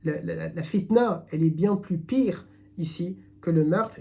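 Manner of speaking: ordinary delivery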